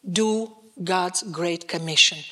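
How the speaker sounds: ordinary talk, nothing marked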